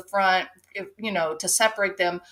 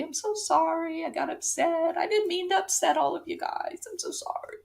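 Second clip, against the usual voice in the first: higher, softer fake sorry, fading to a whisper; mock apology; 7 semitones higher, 7 dB quieter, faster than usual. Woman apologizing to passengers, mock contrite and soft. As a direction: sweetly pretend to be sorry. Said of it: "she's probably gonna tell everybody".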